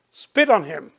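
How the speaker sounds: ordinary talk, nothing marked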